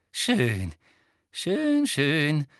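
genüsslich, mit hohem Hüpfer in der Mitte; triumphierend. A narrator's reading. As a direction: koste den Sieg bei jedem Wort aus. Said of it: "mit triumphierender Miene". (selbstgefällig triumphierend)

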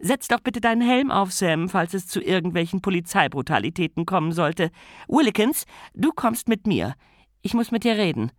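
bustling hostess giving orders; Lady Sybil voice. Acted.

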